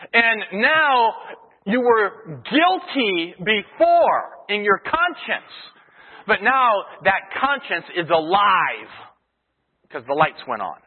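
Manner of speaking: loud, high, driving sermon voice; preaching at full force. Acted. Pastor to congregation, preaching at full force. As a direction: preach it out with rising fire. Preaching about conscience and authority.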